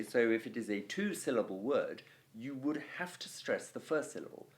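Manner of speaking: usual speaking voice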